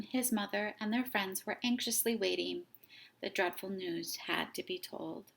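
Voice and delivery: plain reading voice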